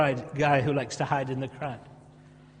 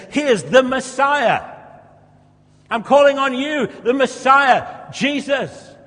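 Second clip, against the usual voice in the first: calling out; 8 semitones higher, 9 dB louder, slower than usual. Acted. Bartimaeus calls out to Jesus.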